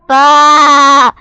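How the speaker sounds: long, high, very loud yell; angry shout. A narrator's reading. Marvin alone in the dark hole, angry shout. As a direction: bellow it out and hold it. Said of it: "shouted Marvin".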